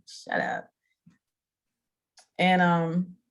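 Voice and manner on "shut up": whispered, softer, playful shut up; mock retort. Answering her niece's teasing, whispered mock retort. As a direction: brush her off in a hushed play-grumble. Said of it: "I'm like".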